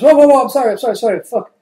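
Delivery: high, loud frantic sorry; yelping, frightened backpedal; panicked apology. Acted. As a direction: beg him to stop hitting. Panicked apology after being slapped.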